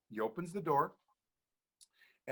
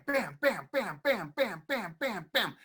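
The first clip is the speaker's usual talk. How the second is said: chair banging sound; 9 semitones higher, 4 dB louder, slower than usual. Retold or imitated.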